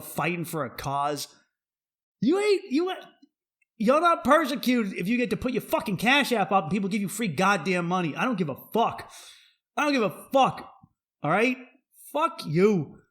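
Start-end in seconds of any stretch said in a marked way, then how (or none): 2.2-8.1 angry rant. Real, not acted. Ranting at OnlyFans girls. 9.7-10.7 higher, louder, punching fuck; louder repeat. Real, not acted. Comedian repeating his defiance, louder and higher. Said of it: "repeated louder". 11.2-13.0 angry rant. Real, not acted. Ranting at OnlyFans girls.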